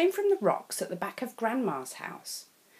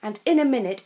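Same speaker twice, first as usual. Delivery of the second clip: usual speaking voice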